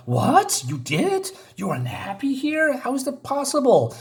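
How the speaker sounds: high-pitched, incredulous questioning; shocked disbelief. Retold or imitated. Friends astonished that she is unhappy here, mock disbelief.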